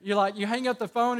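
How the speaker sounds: usual speaking voice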